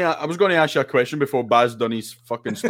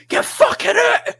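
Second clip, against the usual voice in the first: high, slow, stretched-out yell at a defender; impersonating the goalie's shout from the highlights; mock goalie yell; slower than usual. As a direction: belt it out like a keeper losing it on the pitch. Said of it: "you just hear him go".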